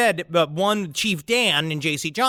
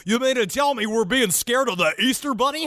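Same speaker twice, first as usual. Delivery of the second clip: higher-pitched incredulous ridicule; George Noory mocking; 6 semitones higher than usual. Radio host making fun of a guest's scary rabbit, mock disbelief. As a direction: make fun of the very idea, pitched up. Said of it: "George Noory was making fun of me".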